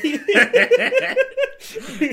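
loud, high burst of laughter. Real, not acted. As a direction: cackle at your own joke. Laughing at "ustedes diez.

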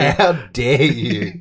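slow, laughing, fake offended; mock outrage. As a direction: drag it out, pretend insulted. Mock offence at jersey joke.

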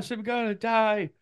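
hammed-up dying patient; mock sickly plea. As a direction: plead pitifully in a high voice. Retelling himself sick and desperate.

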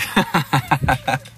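real amused laugh. Real, not acted. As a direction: laugh at your own story. Laughs at posing for picture.

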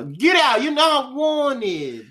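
high shout, last words drawn out slowly; mock angry yell without country accent. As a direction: redo the eviction as a hammed-up holler. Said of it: "they didn't say it with a country accent".

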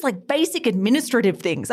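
usual speaking voice

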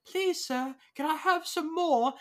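high-pitched mock British orphan plea; hammed-up British urchin voice; horrible British accent. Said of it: "doing a horrible British accent".

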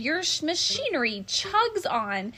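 snapping. Acted. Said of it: "snapped the Lorax".

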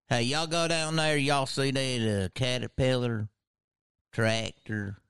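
slow, stretched-out Southern drawl; mock Arkansas accent. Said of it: "we have in Arkansas".

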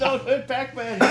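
high, slow pleading voice; mock plea. Joking about the Pixels scene, high mock plea. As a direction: beg them to spare Pac-Man.

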